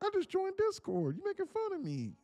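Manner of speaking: goofy Larry voice. Retold or imitated. Mocking the Discord guy named Larry, high and squeaky. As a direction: ham up a silly newbie introducing himself.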